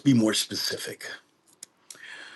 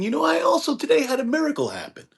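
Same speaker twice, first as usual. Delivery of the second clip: retold teacher, pitched well up and quick; bright mock-solemn telling; teacher's quote, high and fast; faster than usual. Kabbalah teacher to his student, pitched high and quick. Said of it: "he says to the student".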